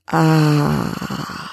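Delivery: long breathy ah fading away; exhaled ah. Shown as a demonstration. Host exhales together with listeners, long sighing ah. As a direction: sigh the whole breath out on ah. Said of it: "now let's exhale together".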